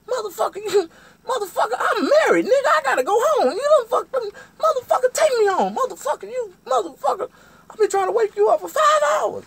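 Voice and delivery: shrieking, very high furious tirade; piercing hammed-up cursing fit; cussing him out. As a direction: act out a screaming woman losing it. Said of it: "this bitch was cussing me out".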